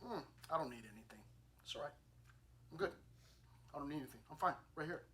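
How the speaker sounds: quick, soft, dismissive mom voice; selfless mom. Retold or imitated. Preacher voicing a mom who wants nothing, soft and quick. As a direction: brush off the offer like a humble mother.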